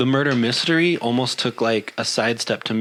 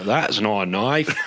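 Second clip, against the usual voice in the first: lightly imitated Australian movie line; Crocodile Dundee; slower than usual. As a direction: draw it out slowly, a hint of Aussie. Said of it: "some Crocodile Dundee trailer".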